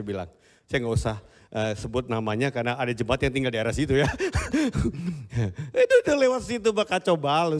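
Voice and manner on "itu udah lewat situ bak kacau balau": scolding. Retold or imitated. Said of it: "saya bilang".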